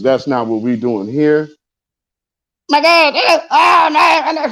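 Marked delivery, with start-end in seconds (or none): 2.7-4.5 very high-pitched mock whining of a caller. Host mocking an emotional caller before telling him to calm down, high mimicry. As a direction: put on a squealing voice to mimic him.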